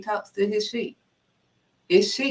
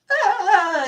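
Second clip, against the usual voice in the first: high, loud put-on groan of effort; exaggerated whiny "ah" of struggle; mock straining wail; 12 semitones higher, 5 dB louder than usual. Believer pretending the load is heavy, mock straining wail.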